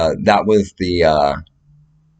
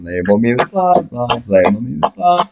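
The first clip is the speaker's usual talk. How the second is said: ordinary talk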